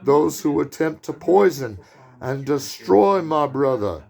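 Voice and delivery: slow, high-pitched hammed-up Brooklyn accent; John Cassavetes Brooklyn accent. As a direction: thunder out a Bible verse in a Brooklyn accent. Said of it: "That was my, kind of my John Cassavetes Brooklyn accent".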